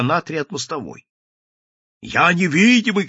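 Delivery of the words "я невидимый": wavering shout. A narrator's reading. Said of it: "кричал голый низким колеблющимся голосом".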